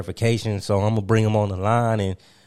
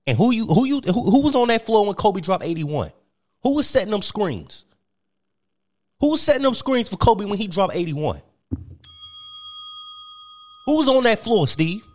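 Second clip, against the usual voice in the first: Kwame Brown ranting; 7 semitones higher than usual. Acted. Kwame Brown demanding credit from Steve, mock indignant. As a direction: hammer the questions in mock outrage. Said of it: "I present to you Mister Kwame Brown".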